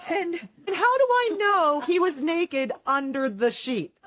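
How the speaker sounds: high, slow question through laughter; laughing. Real, not acted. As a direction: ask it while cracking up.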